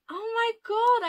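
high, loud delighted shock; excited high-pitched gasp of delight; surprised squeal. Real, not acted. Delighted by stickers.

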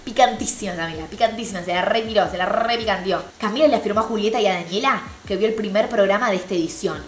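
ordinary delivery

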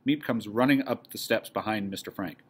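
normal talking voice

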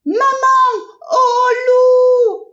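high, loud crying scream for mum; panicked scream. Acted. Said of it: "hurla le petit lapin". (hurlement aigu et paniqué)

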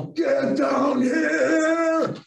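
high, long drawn-out holler; sustained summoning yell; hollering upstairs. Retold or imitated. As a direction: call the kids down, stretching every word. Calls kids down from upstairs.

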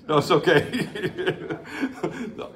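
normal talking voice

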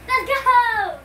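loud, high cheer; excited shout. Real, not acted. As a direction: shout it with glee. Countdown before releasing the toy.

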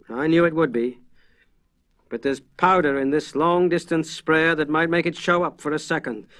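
high, loud, steady reassurance; calm the frightened men down; reassuring. Acted. Armitage reassures the frightened farmers, firm and calm. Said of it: "He made his voice as reassuring as possible".

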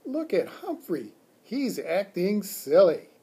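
put-on high-pitched amused little girl voice; high, tickled child's remark; giggly schoolgirl. A narrator's reading. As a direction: point out the silly pet like a giggly child. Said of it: "I heard Rosie giggle".

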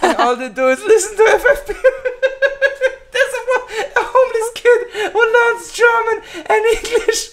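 very high, squeaky voice through real laughter; helpless laughter. Real, not acted. Host cracking up at homeless listeners joke, high laughing squeal.

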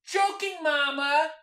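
high-pitched holler; yelling. Acted. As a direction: shout it across to mama. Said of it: "I yell at my mama".

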